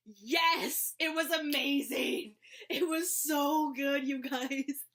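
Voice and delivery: loud, high, drawn-out rave; excited gushing. Real, not acted. Vlogger raving to viewers about the audiobook, excited gushing. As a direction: gush at full volume, stretching the words.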